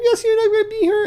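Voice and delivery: high falsetto, fast and upset; mock girl voice. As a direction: rattle it off like a panicking girl. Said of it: "She's like".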